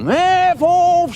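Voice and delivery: high mock scream; retold scream. Witness imitating the neighbour screaming at her door, high mock scream. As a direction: belt it out like the woman at the door. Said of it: "she was screaming".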